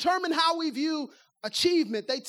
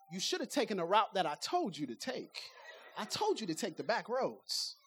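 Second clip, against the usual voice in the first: much softer, lower muttered gripe; grumbling complaint; 7 semitones lower, 8 dB quieter than usual. Retold or imitated. Passenger complaining about the driver's route, softer and lower. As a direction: grumble it under your breath.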